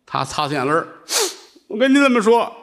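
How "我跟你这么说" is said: high, pleading complaint; whiny seller. Acted. Vegetable seller whining to the nuns, high and pleading. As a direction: plead your case, pitched up.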